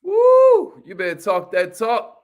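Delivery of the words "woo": loud, high whoop of joy; excited woo. Real, not acted. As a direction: cheer the caller like at a rally. Cheering a caller on.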